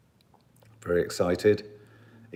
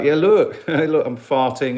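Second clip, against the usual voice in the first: mock voice, laughing; 5 semitones higher, 10 dB louder, slower than usual. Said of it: "this is like".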